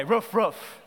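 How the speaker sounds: mock dog woof; playful imitated dog barking. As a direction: bark "Roof, roof" like a dog. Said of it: "That's what dogs say".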